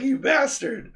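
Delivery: high-pitched mock outrage; retold anger. Cursing the seller over the phone, mock angry. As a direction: curse him in indignant, acted disbelief. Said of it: "I'm so pissed off".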